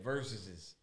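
ordinary delivery

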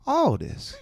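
high put-on voice of the woman shopper; high-pitched imitation of a blunt customer; imitating woman customer. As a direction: mimic her high, accented demand. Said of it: "And she goes".

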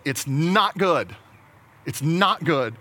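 "not" drawn out and punched; slow down and lean hard on "not"; weighty, emphatic "not good"; stress on not. Acted. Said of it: "The Lord God said".

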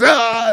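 high mocking crowd ah; mock crowd gasp. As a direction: gasp like an adoring audience. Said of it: "and everyone goes".

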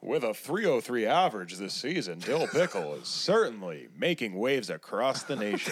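swooping sportscast cadence; mock baseball announcer. Commentating on the friend's pretend season, announcer cadence. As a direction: roll it out like play-by-play.

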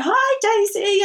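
high, drawn-out imitated hello; friendly greeter. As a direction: imitate someone cheerfully greeting a child. Acted stranger greeting her daughter.

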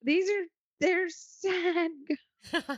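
high-pitched, laughing through the words; laughing dismay. Real, not acted. Co-host reacting to sad ghost stories, laughing in dismay. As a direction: break into a high laugh mid-phrase.

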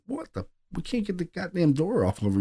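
high, soft, strained voice; strained alarmed cry. Retold or imitated. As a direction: squeak it out mid-lift in disbelief. Straining to lift the heavy door.

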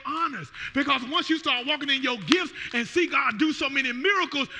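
loud, high shouted preaching; fiery preaching. Real, not acted. Pastor testifying healing to church, loud shouted preaching. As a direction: shout the praise to the rafters.

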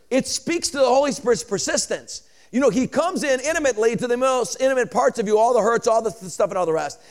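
high, fervent preacher climbing into a shout; impassioned pulpit crescendo, pitch far up; full-force preaching. Real, not acted. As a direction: build relentlessly to a roaring amen.

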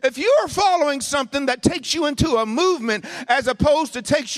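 high, loud, driving sermon delivery; full-force preaching. Real, not acted. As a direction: preach it hard and high, hammering key words.

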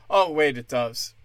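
usual speaking voice

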